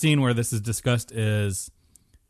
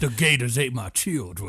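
bayou swamp man. Pretend. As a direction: play a swamp local from the bayou. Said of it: "the guy from the bayou".

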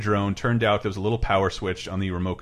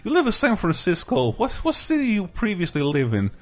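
put-on high-pitched generic foreign accent; Brendan Small's immigrant character; 8 semitones higher than usual. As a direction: imitate a call agent with a comedy immigrant accent. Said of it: "exactly like Brendan Small's impression of, like, generic immigrant character".

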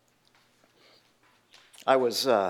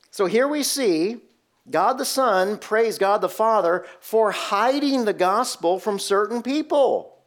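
ordinary talk

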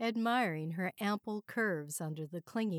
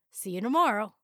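louder, higher parting call; yelled over shoulder; 4 semitones higher, 8 dB louder, faster than usual. A narrator's reading. Man rushing out to the receptionist, yelling goodbye. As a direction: call back as you hurry away. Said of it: "he yelled over his shoulder".

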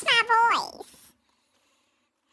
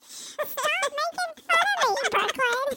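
mock high whine; 4 semitones higher, slower than usual. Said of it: "you're like".

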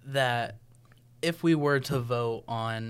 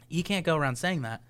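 ordinary talk

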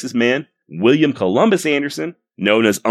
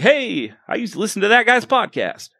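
higher, lightly voiced surprised old listener; a hint of an old-timer's sudden recognition; imagined listener recognizing him; 10 semitones higher than usual. Future listener hearing the old guy's claim, lightly acted higher recognition. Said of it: "I want at least one of y'all to be like".

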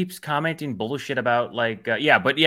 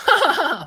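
imitated high laugh; 4 dB louder than usual. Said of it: "it's like".

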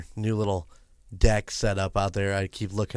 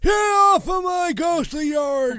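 put-on grumpy old man; 6 dB louder than usual. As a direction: bellow at the kids off your yard. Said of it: "That's pretty good".

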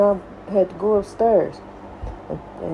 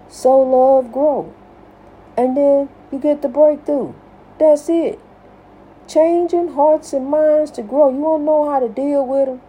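high, louder rising sermon voice; preaching; 6 semitones higher, 3 dB louder than usual. Acted. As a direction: lift it up like a preacher. Preaching builds up.

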